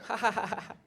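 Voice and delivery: high, staccato fake laugh; put-on ha-ha laugh; mock crowd laughter. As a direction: imitate an audience laughing in even beats. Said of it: "with the crowd going".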